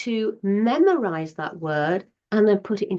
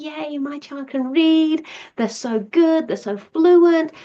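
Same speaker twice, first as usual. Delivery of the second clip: high, excited cheer, swelling louder; proud parent; 6 semitones higher, 3 dB louder than usual. Acted. Parent thinking their child can read, delighted and proud. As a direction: gush with delight, stretching the praise.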